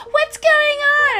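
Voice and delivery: put-on high, slow, loud whiny kid voice; mock confused kids. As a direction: stretch it like a clueless child. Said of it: "they're like".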